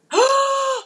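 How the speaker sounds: sharp high gasp of surprise; loud gasp. Real, not acted. Host gasping as a viewer's support appears. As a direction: gasp out loud, caught off guard.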